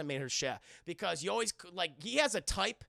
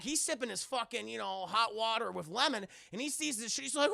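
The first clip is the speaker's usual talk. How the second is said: ordinary delivery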